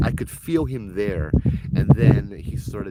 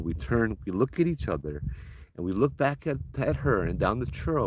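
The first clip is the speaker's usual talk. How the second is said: ordinary delivery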